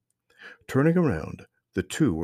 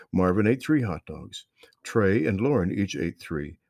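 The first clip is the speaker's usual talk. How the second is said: usual speaking voice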